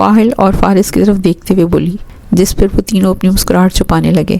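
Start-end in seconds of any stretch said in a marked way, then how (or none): none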